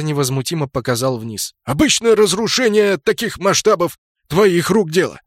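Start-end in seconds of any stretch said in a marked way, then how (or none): 1.6-5.2 high, forceful retort; loud accusation. A narrator's reading. Knight blames Ganker for the destruction, loud and high. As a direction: pin the blame at full voice.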